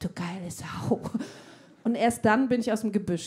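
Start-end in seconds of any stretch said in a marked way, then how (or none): 0.0-1.0 lewd compliment. Retold or imitated. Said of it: "hab ich was Nettes gesagt".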